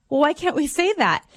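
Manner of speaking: hint of a puzzled kid pushing back; lightly voiced little girl. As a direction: retell a child asking why. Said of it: "we have a six-year-old daughter".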